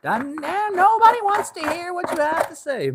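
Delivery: very high, loud country grandma; grandmother impression. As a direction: scold them like a high-voiced country grandma. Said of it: "My grandmother would just not care".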